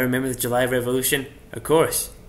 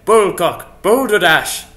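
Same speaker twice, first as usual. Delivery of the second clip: loud, raised cry of disbelief; indignant outburst; 4 semitones higher, 8 dB louder, much slower than usual. Acted. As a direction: burst out in theatrical indignation. Indignant outburst in acted rebuttal.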